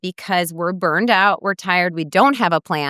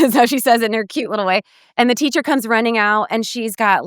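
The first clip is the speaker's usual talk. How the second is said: ordinary talk, nothing marked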